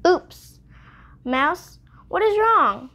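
startled rabbit. Acted. Rabbit trips over Mouse's tail.

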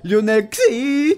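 Xi drawn out on a rising high note; shooting up high. Shown as a demonstration. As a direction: slowly send "Xi" up high, playfully. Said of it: "ça part dans l'aigu".

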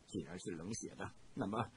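quiet, low self-talk; low muttering to himself. A narrator's reading. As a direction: murmur it to yourself, cold. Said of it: "唐龙低声自语着".

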